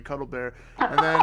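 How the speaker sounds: ordinary talk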